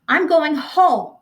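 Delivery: high, slow, trembling plea; quavering. A narrator's reading. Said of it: "quavered Missus Bowles".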